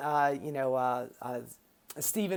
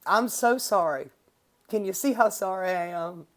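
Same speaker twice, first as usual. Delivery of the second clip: ordinary talk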